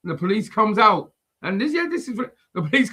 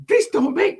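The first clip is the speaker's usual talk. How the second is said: high sputtering woman; hammed-up indignant splutter; lightly voiced Karen; mock Karen; 12 semitones higher, 4 dB louder than usual.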